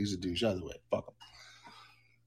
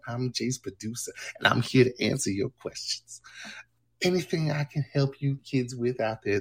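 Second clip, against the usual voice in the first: Jessica character voice. Acted. Streamer plays his producer Jessica answering chat, sassy put-on voice. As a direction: play Jessica, the sassy producer, taking the mic. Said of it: "Here's Jessica".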